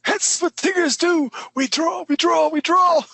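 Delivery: very high bouncy cartoon tiger; Tigger voice.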